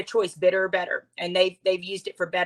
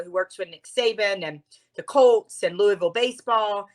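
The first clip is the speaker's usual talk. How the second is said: ordinary delivery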